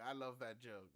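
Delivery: usual speaking voice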